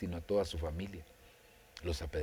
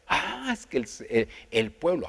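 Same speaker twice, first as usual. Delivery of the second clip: a much higher, louder stammered excuse; flustered excuse; 7 semitones higher, 8 dB louder than usual. Retold or imitated. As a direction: blurt it out, caught out and shifting blame. Saul makes excuses to Samuel.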